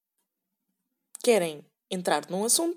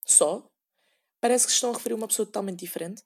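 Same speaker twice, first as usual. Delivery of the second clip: ordinary delivery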